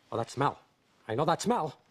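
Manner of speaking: ordinary talk, nothing marked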